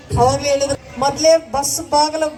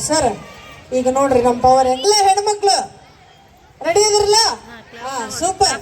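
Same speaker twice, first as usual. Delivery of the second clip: ordinary talk, nothing marked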